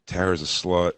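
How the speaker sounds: sly rumor. Pretend. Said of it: "eke it out to some little kid".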